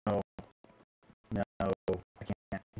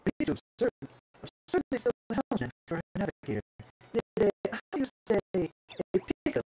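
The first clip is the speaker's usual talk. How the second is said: higher-pitched, theatrical pleading; pompous ship owner; 11 semitones higher, 3 dB louder, slower than usual. Acted. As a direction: explain the predicament with fussy grandeur. Same game figure continues.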